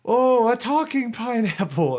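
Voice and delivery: high, loud, wide-eyed mock wonder; mock amazed kids. Retelling the French show, kids amazed by the pineapple. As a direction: act dazzled by a talking fruit. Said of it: "they're like".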